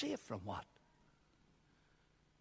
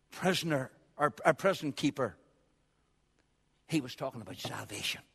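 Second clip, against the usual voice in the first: ordinary talk, nothing marked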